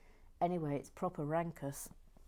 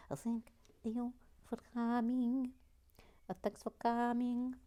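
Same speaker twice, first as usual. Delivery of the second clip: higher, odd theatrical voice; over-formal sign-off; mock grand thanks; 5 semitones higher than usual.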